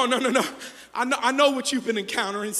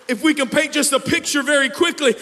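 normal talking voice

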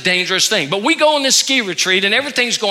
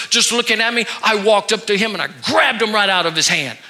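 ordinary talk, nothing marked

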